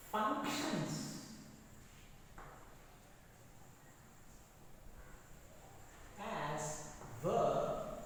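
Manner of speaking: drawn-out words with long gaps; slow dictation. Real, not acted. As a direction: dictate slowly, phrase by phrase. Writing the rule on the board.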